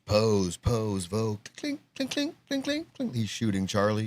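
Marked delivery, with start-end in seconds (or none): 1.6-3.1 very high, soft clink clink noises; camera clink imitation. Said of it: "like, a camera's clink".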